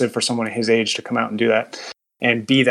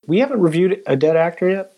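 higher-pitched, surprised query; surprised question; 7 semitones higher than usual. Real, not acted. Co-host checking past reviews with the panel, surprised. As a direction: ask it as if caught off guard.

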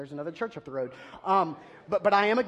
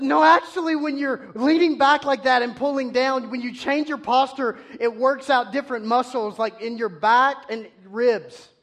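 proud know-it-all reply; 6 semitones higher, 3 dB louder than usual. Retold or imitated. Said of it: "I said".

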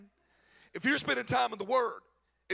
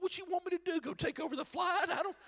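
high exasperated dad; 9 semitones higher than usual. Retold or imitated. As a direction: voice jumps higher, helpless dad. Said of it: "I'm like".

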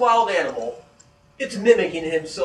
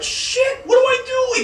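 high, loud panicked shout; 7 dB louder than usual. Retold or imitated. Bob frozen before the creature, high panicked shout. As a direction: cry out in panic. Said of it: "he's like".

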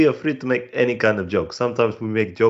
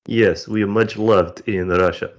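hammed-up Russian accent; broad comic Russian accent; mock Russian accent; 3 dB louder than usual. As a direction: play it as a proud Russian comedian in a thick Russian accent. Comic Russian guest persona.

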